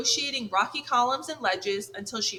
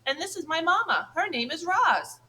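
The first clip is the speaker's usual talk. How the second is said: shouting over waves; 7 semitones higher than usual. Acted. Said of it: "he shouted over the waves".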